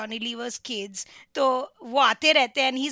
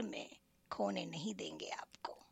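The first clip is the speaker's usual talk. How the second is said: whispered punchline; 5 semitones lower, 15 dB quieter than usual. Acted. Poem punchline, listeners go wah.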